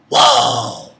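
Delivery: loud, high whoa falling away; re-enacted burst of amazement; stunned reaction. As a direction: blurt it out, amazed. Retold reaction to canon scene.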